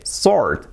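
"sword" said as "sord"; correct sword. Shown as a demonstration. Teacher confirming how it is said, silent-w sword. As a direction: drop the w completely in "sword". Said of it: "Así se dice".

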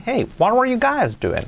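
"Hey, what were you guys doing" pitched very high, then falling; high swooping "Hey" and "were"; emphatic intonation. Shown as a demonstration. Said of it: "muy importante la entonación".

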